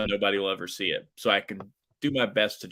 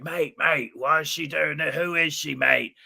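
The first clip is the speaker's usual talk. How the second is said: high, baffled New Zealand voice; put-on New Zealand accent, pitched up; thick New Zealand accent; 6 semitones higher than usual. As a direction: imitate a flustered New Zealander. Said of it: "New, New Zealand accent".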